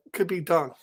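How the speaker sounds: usual speaking voice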